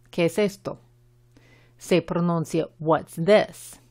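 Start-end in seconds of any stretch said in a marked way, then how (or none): 2.8-3.8 "this" with a short English I; "this" said with a short, lax I. Shown as a demonstration. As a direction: model "What's this?" keeping the I in "this" short. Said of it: "la I es la I corta del inglés".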